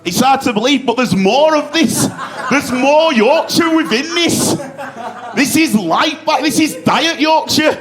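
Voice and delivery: exaggerated Yorkshire accent, played up for laughs; broad Yorkshire turned up. Shown as a demonstration. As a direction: lay the Yorkshire on extra thick, a bit shouty. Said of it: "I turn this up".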